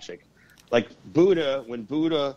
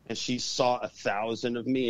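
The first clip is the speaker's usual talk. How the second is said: ordinary talk, nothing marked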